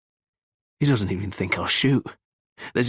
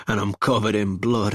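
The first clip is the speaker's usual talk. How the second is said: ordinary delivery, nothing marked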